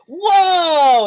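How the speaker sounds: loud, high, long-held exclamation; excited whoa. Acted. Belle runs to the dog, excited shout. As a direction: cry out in wide-eyed excitement. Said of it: "Belle's like".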